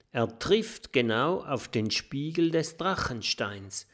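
usual speaking voice

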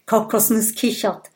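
usual speaking voice

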